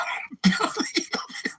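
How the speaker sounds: high, wheezy, voiceless laugh; helpless cracked-up wheeze; real laughing fit. Real, not acted. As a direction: laugh until the breath runs out. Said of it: "I can't stop laughing".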